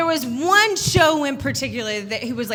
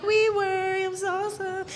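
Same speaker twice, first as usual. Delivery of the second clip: put-on high, soft elderly voice; cute old couple; 6 semitones higher, 6 dB quieter than usual. Cute old couple married fifty years, high soft voice. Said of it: "amazingly cute old couple".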